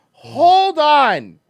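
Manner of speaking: high, loud drawn-out call; disbelieving halt. Real, not acted. Reacting to the accent, loud halt.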